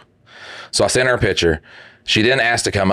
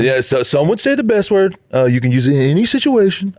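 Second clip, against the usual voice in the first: higher, emphatic mock Trump voice; trying a Trump voice; 9 semitones higher than usual. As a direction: oversell it like a braggy politician. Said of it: "I can't do a Trump impression".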